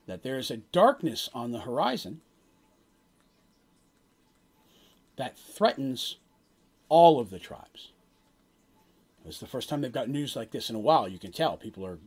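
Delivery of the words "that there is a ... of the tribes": slow, portentous prophecy with long pauses; solemn prophet. Acted. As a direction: deliver a grave omen, phrase by phrase. Game master voices the prophet's prophecy.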